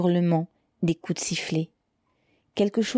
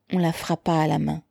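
usual speaking voice

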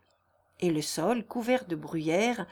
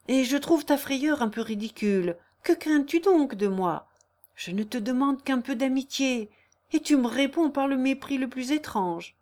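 higher, wounded lover's protest; lightly acted indignant suitor, pitched up; reproachful suitor; 5 semitones higher, 4 dB louder than usual. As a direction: reproach her with hurt pride. Narrator plays reproachful Léon.

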